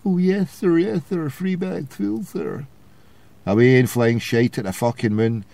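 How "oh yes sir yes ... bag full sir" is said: high, prissy toff, fawning; much higher, put-on upper-class grovelling; mock posh English voice. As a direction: sneer it out like a stuck-up Labour type. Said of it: "you can hear how their voices become ever more stuck up their own chuffs".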